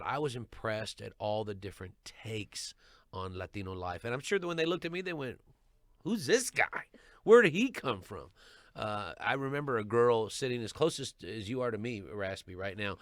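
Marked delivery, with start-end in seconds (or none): 6.1-8.3 high, loud mock disbelief; puzzled audience member, hammed-up; incredulous onlookers. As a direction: imitate baffled onlookers, pitched up and punchy. Voicing onlookers puzzled by him.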